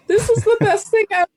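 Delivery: high-pitched, fast gush; gushing excitement. Retold or imitated. Acting out childhood reaction to video.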